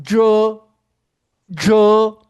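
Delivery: jo loud, nasal vowel, curled tongue; model syllable. Shown as a demonstration. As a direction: belt out the model jo. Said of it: "usando o A anasalado". (jo alto e claro como modelo)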